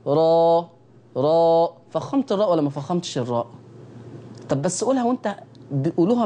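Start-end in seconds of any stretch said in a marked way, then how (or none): 0.1-1.7 را pronounced full and thick; heavy ra. Shown as a demonstration. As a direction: model را with a thick r. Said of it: "قول كده".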